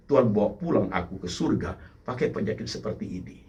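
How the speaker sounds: a hushed contemplative prayer spoken as a believer; quiet prayer. Acted. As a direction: pray it humbly to God. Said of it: "Suatu kontemplasi".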